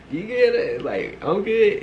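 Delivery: high-pitched mocking echo of a question; mock retort. Throwing his "you good" back at him, high and mocking.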